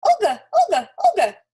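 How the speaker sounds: very high, loud yappy squirrel call; chirpy yappy voice. A narrator's reading. Trapped squirrel calling after Olga, chirpy and yappy. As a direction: yap it out like a chirpy little squirrel. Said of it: "in a chirpy, yappy kind of voice".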